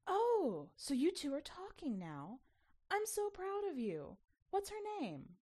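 high, bright, proud mother's voice; proud mommy. A narrator's reading. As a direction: gush with pride like a mom. Mommy proudly praising her child.

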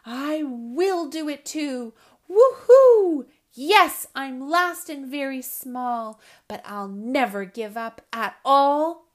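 loud triumphant owlet, whooping midway; crying out determinedly. Acted. Smallest owlet launches into the storm, crying out. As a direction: belt it out like a small bird refusing to quit. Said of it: "stretched and strained and flapped and cried".

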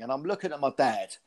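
normal talking voice

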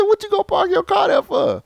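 very high pitched, loud incredulous scolding; exasperated scold. Retold or imitated. Man berating girlfriend over her car, high exasperated scold. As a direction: let her have it, half laughing.

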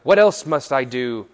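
loud question; big, emphatic acted asking; young man's eager question. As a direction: fire the question out loudly. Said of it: "the young man replied".

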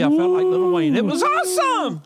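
high, drawn-out rich-man boast; excited boast. Acted. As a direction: show off like a rap star. [name] boast, high and loud.

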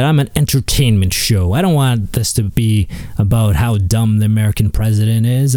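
ordinary talk

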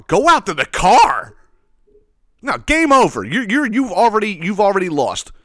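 loud, high outraged disbelief. Real, not acted. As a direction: blow up at the absurd excuse. Outraged rant at customer.

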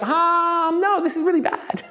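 high, drawn-out wail of dismay; mock dismay. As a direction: act out someone panicking, pitch up. Voicing someone in distress.

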